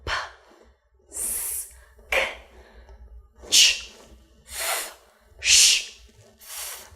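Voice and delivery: voiceless p, s, k, ch, f, sh, th as bare sounds; isolated consonant sounds. Shown as a demonstration. Teacher listing verb endings, each as a voiceless sound. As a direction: make each consonant alone with only air, pausing between. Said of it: "verbs that end with the letter".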